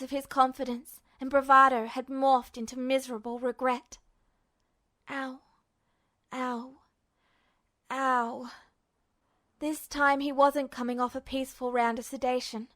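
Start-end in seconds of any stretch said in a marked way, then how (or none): 5.1-8.5 soft, weak, hurting; pained groans. Acted. Ed wakes hurt, soft pained ow. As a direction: wince it out. Ed waking in pain.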